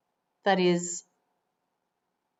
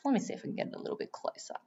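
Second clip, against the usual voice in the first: quick whisper to not disturb; hushed, rushed aside; 5 semitones higher, 15 dB quieter, much faster than usual. Real, not acted. Adjusting camera near penguins.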